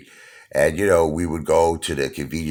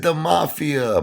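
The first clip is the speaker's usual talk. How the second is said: high-pitched mock wail; imitated hysterical crying; 12 semitones higher, slower than usual. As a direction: cry it out like a distraught mother. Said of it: "hysterical crying".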